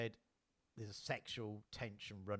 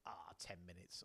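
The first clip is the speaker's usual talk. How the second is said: whispered aside; 5 semitones lower, 11 dB quieter than usual. Real, not acted. Checking the time aside.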